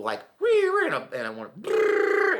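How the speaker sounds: high, loud party-horn noises; mock noisemaker brrr; noisemaker imitation. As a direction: buzz like a New Year's noisemaker. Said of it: "one of those noisemaker things".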